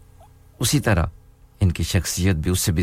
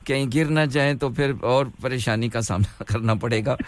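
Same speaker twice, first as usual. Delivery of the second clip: laughing; 7 semitones higher, 3 dB quieter than usual. Real, not acted. Teasing caller about falling off.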